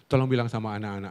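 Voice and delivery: ordinary talk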